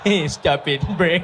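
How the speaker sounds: trying to scold while breaking into giggles; laughing scold. Acted. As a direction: tell the child off but lose it laughing.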